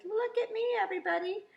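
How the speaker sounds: high, loud call to the crowd; proud Wemmick shouting to everyone from her box; shouted. Acted.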